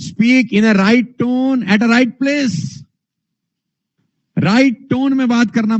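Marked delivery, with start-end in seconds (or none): 0.1-2.8 very slow and higher, every word spaced; slow, emphatic. Shown as a demonstration. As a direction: spell it out slowly like a teacher. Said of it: "how you speak". (बहुत धीरे, ज़ोर देकर)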